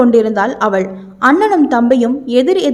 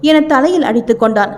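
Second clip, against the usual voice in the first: usual speaking voice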